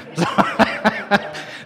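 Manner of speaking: breathy, voiceless chuckle; amused laugh. Real, not acted. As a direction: chuckle at your own story. Laughs at his own story.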